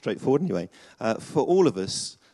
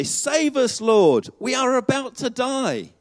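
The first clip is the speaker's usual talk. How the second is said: high, desperate plea for rescue; fearful cry; 13 semitones higher, 5 dB louder, slower than usual. Acted. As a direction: cry out like frightened fishermen. Disciples cry out in storm.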